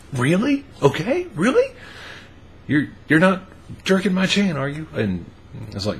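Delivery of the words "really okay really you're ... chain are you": can't believe her luck; astonished disbelief. Retold or imitated. She hears he'll quit.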